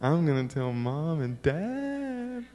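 soft, drawn-out sing-song threat; slow taunting kid voice, rising and stretched; tattling child. Retold or imitated. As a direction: imitate a kid gleefully threatening to tell. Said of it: "I was like".

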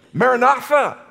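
ringing high call of Maranatha. Real, not acted. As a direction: lift Maranatha up to the congregation.

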